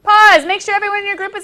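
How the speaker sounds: loud call. Real, not acted. Teacher to noisy class, calling for attention, loud. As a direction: call Pause over the room. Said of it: "calls the class to stop".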